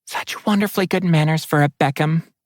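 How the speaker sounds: high, gushing water spirit; enthusiastic spirit voice. Acted. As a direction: gush about his manners, up high. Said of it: "continued enthusiastically".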